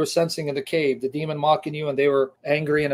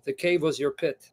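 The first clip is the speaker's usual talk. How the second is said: normal talking voice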